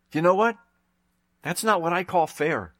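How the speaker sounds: ordinary delivery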